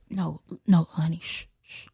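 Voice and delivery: very soft hushing; shushing softly. Retold or imitated. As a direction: soothe her quiet with shh. Hushing wife on phone.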